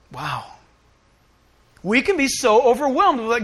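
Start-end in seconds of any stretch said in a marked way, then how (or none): none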